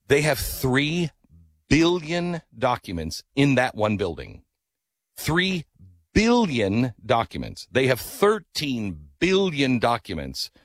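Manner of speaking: "three" and "billion" drawn out high, with pauses; slow, high stress on each huge number; awed emphasis. Real, not acted. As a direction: stretch out "three billion" and "thirteen billion" in amazement. Marvels at the archive's huge numbers.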